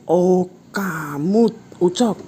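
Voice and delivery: high, slow startled voice; be caught off guard. Acted. Ujang surprised to find Ucok behind him, startled. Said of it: "Ujang kaget berkata".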